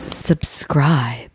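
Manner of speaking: hoarse imitated ghost whisper; ghostly whisper. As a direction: breathe it out like an eerie voice. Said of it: "you can hear him say".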